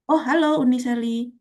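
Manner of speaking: ordinary talk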